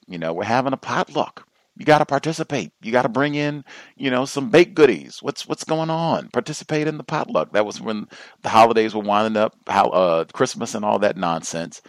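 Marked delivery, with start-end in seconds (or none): 0.3-7.5 high-pitched, insistent mock nagging; pushy coworker hounding. Coworker pestering staff about the holiday potluck, mock hounding. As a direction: imitate someone badgering people to join in. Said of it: "where you were being hounded".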